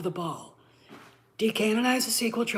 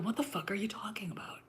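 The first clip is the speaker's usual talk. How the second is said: whispered retort; 9 dB quieter than usual. Retold or imitated. Retorting to angry ignorant fans.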